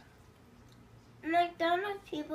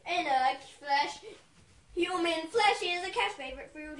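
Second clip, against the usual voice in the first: usual speaking voice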